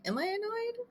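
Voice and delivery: high, soft questioning voice; wondering self-check. Retold or imitated. Wife checking herself after snapping, high soft wondering. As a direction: pitch it way up, quietly asking yourself.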